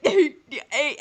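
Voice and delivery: ordinary talk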